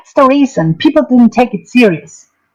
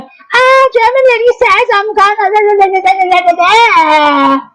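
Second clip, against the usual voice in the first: much higher, fast, sing-song mockery of her chatter; mock high squeaky imitation; 9 semitones higher than usual. Mocking the German lady's panel talk in a much higher squeaky voice. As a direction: parody her babbling on guest mode.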